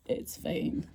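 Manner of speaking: soft, breath-only soothing; hushed, easygoing comfort; whispered reassurance. Retold or imitated. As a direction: whisper it to calm someone down. Imitating John's easy reassurance.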